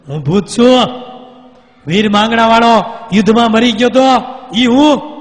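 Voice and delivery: high, loud proud ghost declaration; booming reveal. Acted. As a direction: announce yourself as the ghost, booming. Ghost reveals himself loudly.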